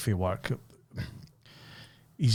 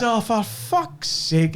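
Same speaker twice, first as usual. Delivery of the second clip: retold exasperation; 7 semitones higher, much slower than usual.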